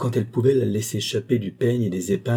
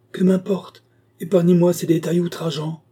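angry outburst; 9 semitones higher, 4 dB louder than usual. Acted. Marquis refuses to hear details.

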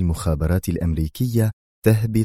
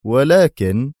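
higher, ominous rising but; grim but; 6 semitones higher, 4 dB louder, much slower than usual. A narrator's reading. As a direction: let the word hang darkly. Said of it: "تجهم وجهه".

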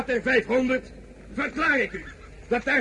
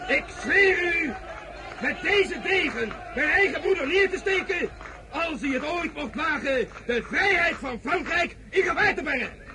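raised, weighty oath; solemn oath; 4 semitones higher than usual. Acted. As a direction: swear it before the troops. Solemn oath before the soldiers.

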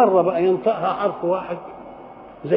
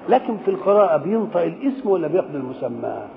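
usual speaking voice

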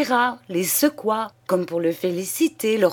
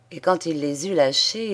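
ordinary delivery, nothing marked

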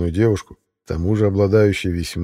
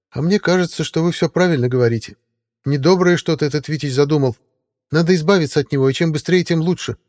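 lightly voiced scheming sidekick, higher; high character voice; 8 semitones higher than usual. A narrator's reading. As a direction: urge getting rid of him. Maximilian voiced in the story.

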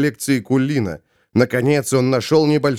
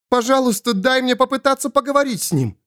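high-pitched, urgent plea; high, hurried entreaty; pleading Svol; 11 semitones higher, faster than usual. Acted. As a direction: beg quickly in a high voice. Svol begs to try.

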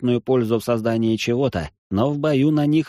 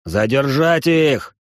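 commanding shout; 5 semitones higher, 4 dB louder, slower than usual. Acted. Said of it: "дал команду".

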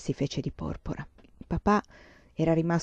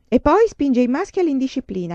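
higher, louder indignant charge; angry accusation; 7 semitones higher, 6 dB louder than usual. A narrator's reading. Teacher accusing Laura before the board, angry and raised. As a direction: pile on the blame.

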